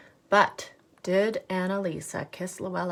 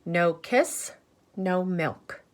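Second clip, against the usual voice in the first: ordinary delivery, nothing marked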